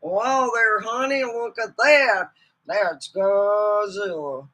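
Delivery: slow Western drawl; Western cowboy. Retold or imitated. Mocking a dubbed Godzilla actor, slow Western drawl. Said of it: "sounding like they were ready for a roundup".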